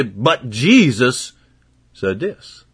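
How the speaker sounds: "But Jesus" stretched very slow and leaned on; slow, deliberate "But Jesus said this"; slow emphatic But Jesus. Shown as a demonstration. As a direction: draw out "Jesus" as a firm answer. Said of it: "you say".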